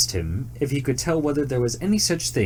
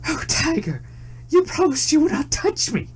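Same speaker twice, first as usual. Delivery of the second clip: frightened cry. A narrator's reading. Betrayed deer crying out to the tiger, high frightened plea. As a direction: cry out in terror and reproach. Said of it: "dreadfully frightened and cried".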